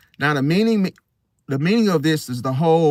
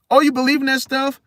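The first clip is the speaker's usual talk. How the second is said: high, rising mock disbelief; incredulous scoffing; 10 semitones higher, 3 dB louder, faster than usual. Doubter dismissing your intuition, high and scoffing. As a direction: question it high, dismissing the belief.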